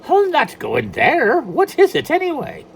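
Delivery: high, loud leprechaun; a tiny leprechaun refusing; wary leprechaun. Acted. Leprechaun Patrick frightened.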